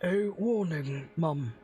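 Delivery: lightly voiced stiff butler; a hint of a proper old servant; formal butler. Butler Rayburn warns.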